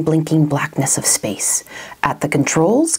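ordinary delivery

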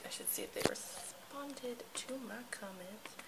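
whisper. Real, not acted. Whispering aside to herself.

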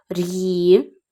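"р'ї" drawn out as a syllable on its own; slow, separate second syllable "р'ї"; isolated syllable. Shown as a demonstration. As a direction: stretch "р'ї" slowly, apart from the word. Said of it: "три склади".

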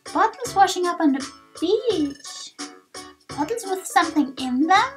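high-pitched, wondering character voice; high, swooping voice puzzling over a discovery; curious game character. Acted. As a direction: pipe up with keen curiosity.

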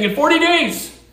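loud, high street-preacher warning; yelling the warning at the whole city; shouted proclamation. Retold or imitated. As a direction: call it out like a doomsday prophet. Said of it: "and he's saying".

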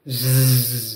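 low buzzing hum; a soft insect buzz. Acted. Narrator imitating the dragonfly, low buzzing hum.

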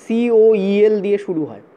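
সিওইএল said letter by letter, slowly; teacher spelling the letters out for the class. Shown as a demonstration. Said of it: "বানানটা actually".

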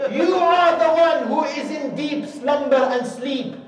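loud, high furious shouting of the questions; Habib's furious loud retort; enraged rebuke. Acted. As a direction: hurl each question back at him. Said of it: "is enraged with anger".

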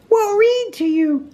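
very high, cheery piping; cheerful Rabbit. A narrator's reading. Rabbit consoling stuck Pooh, high and cheerful. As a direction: chirp it brightly in a very high voice. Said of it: "said Rabbit cheerfully".

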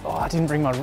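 ordinary delivery

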